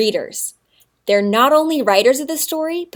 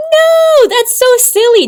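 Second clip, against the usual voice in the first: amused no; 12 semitones higher, 8 dB louder, slower than usual. Real, not acted. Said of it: "Did I look really silly".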